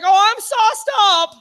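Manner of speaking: slow, high, loud mock giddiness; giddy admission. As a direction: stretch it out high and loud, comically intoxicated. High, drunk reply to mother.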